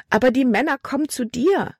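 hohe, gehetzte Mädchenstimme; ängstliches Mädchen. A narrator's reading. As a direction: sprich als verängstigtes Mädchen. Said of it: "Die Worte sprudelten aus Libby heraus". (Tochter drängt ihren Vater, hoch und ängstlich)

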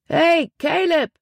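very high, drawn-out hail; long high-pitched call. A narrator's reading. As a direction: hail a friend across a crowd, stretching the words. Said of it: "rief er".

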